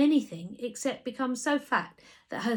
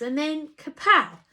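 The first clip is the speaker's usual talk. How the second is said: excited kapow; 6 semitones higher, 8 dB louder than usual. A narrator's reading. Narrator bursting out with his sudden idea, excited. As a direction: burst out louder and higher.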